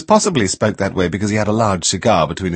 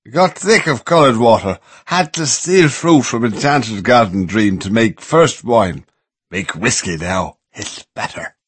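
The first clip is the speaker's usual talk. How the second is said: put-on drunk; 3 semitones higher, 3 dB louder than usual. A narrator's reading. Drunk Bimbo speaks.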